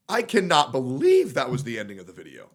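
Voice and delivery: normal talking voice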